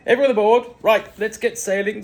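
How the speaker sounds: high, loud shout aboard; rally everyone on deck, loudly; loud call to all. A narrator's reading. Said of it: "said Dennis".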